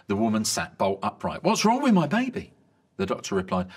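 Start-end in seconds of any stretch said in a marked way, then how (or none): 1.4-2.5 high, loud frightened cry; alarmed mother. Acted. New mother fearing for her baby, alarmed cry. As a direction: blurt it out in panic.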